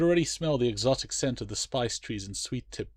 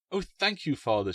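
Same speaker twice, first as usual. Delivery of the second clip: put-on grateful girl; 6 semitones higher, 3 dB louder than usual. Alice thanks father.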